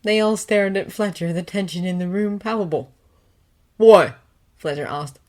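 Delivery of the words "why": sharp suspicious question. A narrator's reading.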